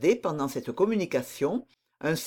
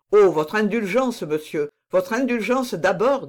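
raised, louder, mildly imploring voice; earnest pleading; 4 semitones higher, 6 dB louder than usual. A narrator's reading. Son begging his father's indulgence, mildly imploring. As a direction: plead with some urgency.

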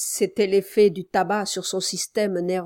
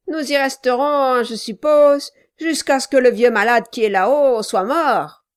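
voix plus aiguë et forte, ordres hargneux; un nain tyrannique qui menace son commis; nain brutal qui commande; 4 semitones higher, 8 dB louder than usual. A narrator's reading. Quilp répond au procureur. (Quilp, le nain brutal, aboie ses ordres)

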